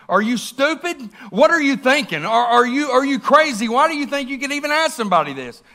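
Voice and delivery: father chewing out the boss on the phone, furious; angry tirade. Retold or imitated.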